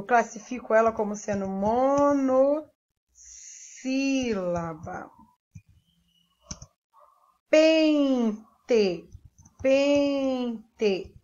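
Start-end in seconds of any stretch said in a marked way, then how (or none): 1.5-5.1 "monossílaba" drawn out with a pause midway; dictating by syllable. Shown as a demonstration. Teacher writes the term. 7.5-9.1 "Pente" as long "pen", pause, "te"; sounding out syllables. Shown as a demonstration. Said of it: "abro a boca duas vezes". 9.6-11.1 "pente" slow, nasal "pen" held, then "te"; sounding out syllables. Shown as a demonstration. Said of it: "abro a boca duas vezes".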